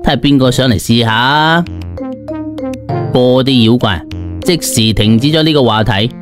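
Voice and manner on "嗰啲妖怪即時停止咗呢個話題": ordinary delivery, nothing marked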